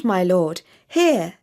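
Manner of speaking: ordinary delivery, nothing marked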